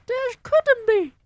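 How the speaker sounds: very high, loud witch shout. Acted. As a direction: shriek in disbelief. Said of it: "the others shouted".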